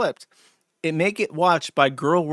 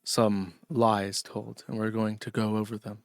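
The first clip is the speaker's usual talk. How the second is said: a mock Scottish YouTuber, lower and dour; slightly lower, gloomy Scottish accent, imitating a commentator; 4 semitones lower than usual. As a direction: do him in a downtrodden Scottish accent, deadly serious. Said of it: "this really dour, downtrodden way of speaking with, like, a Scottish accent".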